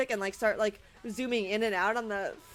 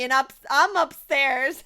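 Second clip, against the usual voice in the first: normal talking voice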